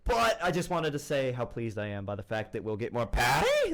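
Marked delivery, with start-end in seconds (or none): 3.1-3.7 high-pitched cartoon villain cry; playful mad-scientist impression; Doctor Doofenshmirtz. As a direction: squeal the name like a cartoon villain. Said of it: "made fun of Doctor Doofenshmirtz on air".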